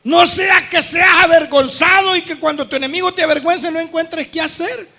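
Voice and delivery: loud preaching. Real, not acted. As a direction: drive the warning home.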